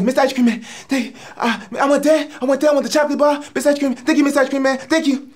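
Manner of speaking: put-on high, stammering little-kid pleading; eager kid. Comic as a kid at the ice cream truck, eager. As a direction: stumble over the order in a high child's voice. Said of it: "And you get like".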